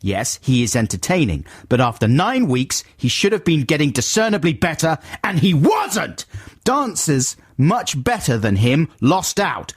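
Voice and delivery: high, exasperated mock shouting; shouting letter-writer. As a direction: rant it out as an outraged complaint. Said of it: "shouting right the way through".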